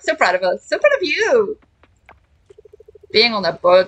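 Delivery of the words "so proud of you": high, bright excited praise. Acted. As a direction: burst out proudly. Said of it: "repeated with more excitement".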